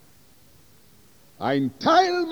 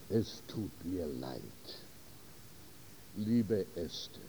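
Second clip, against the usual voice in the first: much lower and softer, barely voiced apology; sorry and subdued; 12 semitones lower, 16 dB quieter than usual. A narrator's reading. Groom apologizing to the bride at the altar, lower and softer. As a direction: sink the voice, ashamed and sorry.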